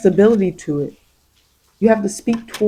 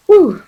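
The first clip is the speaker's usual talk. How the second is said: a loud, high breath of relief; relieved whew; 9 semitones higher, 9 dB louder than usual. Real, not acted. Reader reacting to the drawn card with a relieved whew. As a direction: blow out a big whew.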